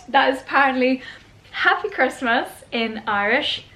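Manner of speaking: ordinary talk, nothing marked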